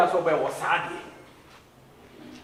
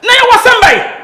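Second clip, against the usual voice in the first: very high, loud, slow furious yell; angry shout; 14 semitones higher, 12 dB louder than usual. Acted. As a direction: bellow it at her in full rage. Heated quarrel between the two.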